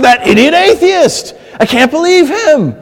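high, loud scoff at the atheist; annoyed rant. Retold or imitated. A believer yelling at the TV about an atheist, annoyed. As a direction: vent at the TV in disgust. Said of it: "you're like".

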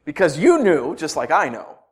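normal talking voice

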